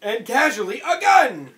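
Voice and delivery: high, loud exclamation; gleeful disbelieving shout; incredulous outburst. Real, not acted. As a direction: build to a yell on the last word. Player exclaims over surprise gun.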